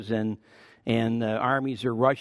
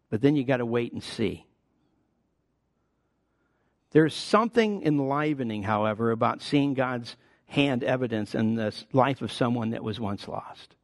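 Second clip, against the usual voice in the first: ordinary delivery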